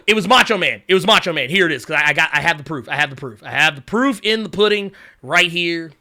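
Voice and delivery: ordinary talk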